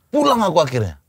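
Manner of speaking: ordinary delivery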